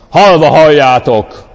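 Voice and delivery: "Halva halljátok" thundered loud and slow; artificially grand, slow proclamation; booming preacher voice. Shown as a demonstration. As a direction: declaim "Halva halljátok" like a pompous pulpit boom. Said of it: "ne tegyél hozzá mesterségesen egy ilyen zengzetes hangzást".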